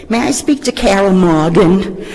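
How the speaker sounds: imitating her brother's Boston accent on the phone. Brother phoning the office for Carol, light Boston accent. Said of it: "this person with the deep Boston accent said".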